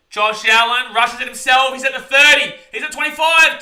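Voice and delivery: rising high and loud as the run goes on; excited play-by-play. Real, not acted. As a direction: call the run like it's live and big. Live call of a quarterback run.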